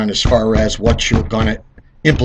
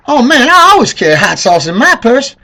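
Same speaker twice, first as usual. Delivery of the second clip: mock Hillary with a tinge; 12 semitones higher, 7 dB louder than usual. Mocking Hillary pandering to voters, high put-on voice. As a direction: ham up her pandering accent. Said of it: "I think she said it like this".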